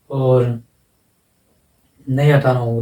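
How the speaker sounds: ordinary delivery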